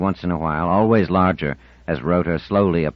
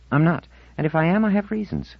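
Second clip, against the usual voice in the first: put-on higher teenage girl, stubborn and touchy; a touchy fifteen-year-old digging in; young girl's voice, defensive; defiant teen girl; 12 semitones higher than usual.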